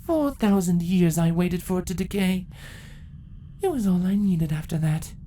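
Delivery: proud empress telling her victory; ancient empress. Acted. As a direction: gloat over the long wait. Empress villain monologue.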